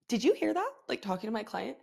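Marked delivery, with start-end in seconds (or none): 0.1-0.7 shocked. Retold or imitated.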